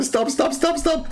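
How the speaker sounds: squeaky high stop stop; high mock pleading.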